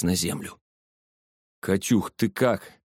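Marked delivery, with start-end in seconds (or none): none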